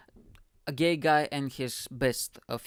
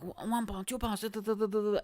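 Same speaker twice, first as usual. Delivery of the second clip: high, softer auction patter; imitated auctioneer's running chant; 7 semitones higher, 3 dB quieter than usual. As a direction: rattle off bids like an auctioneer. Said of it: "I'm hyping up the energy".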